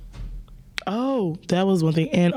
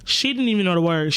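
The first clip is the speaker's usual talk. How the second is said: normal talking voice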